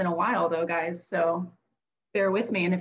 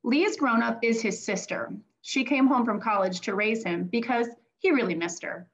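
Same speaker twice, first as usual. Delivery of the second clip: gentle read-aloud to a class; picture-book reading to children; reading like a teacher. Acted. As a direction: read it like a teacher to young kids. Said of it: "I'm gonna read it like a teacher".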